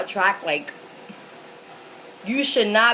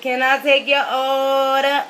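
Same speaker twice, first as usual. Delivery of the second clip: slow, drawn-out surly server; mad attitude; 3 semitones higher, 3 dB louder, slower than usual. Retold or imitated. McDonald's girl taking her order, with mad attitude. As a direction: imitate a cashier with a mad attitude. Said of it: "with a mad attitude".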